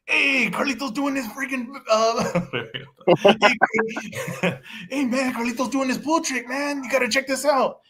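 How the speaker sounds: high, hammed-up Puerto Rican gangster accent; a Puerto Rican hustler from Carlito's Way, pushed way up high; Carlitos Way impression. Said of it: "Please, please do it. Right, right here".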